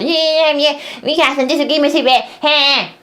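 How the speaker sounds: quick, dismissive brush-off in a put-on voice; mock grifter. Mocking grifters who dodge disagreement to his face with a lightly put-on voice. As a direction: hurry past it like a grifter dodging. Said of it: "grifters always".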